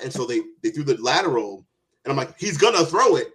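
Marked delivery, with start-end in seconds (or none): 2.4-3.3 excited. Retold or imitated. Retold excited prediction at TV.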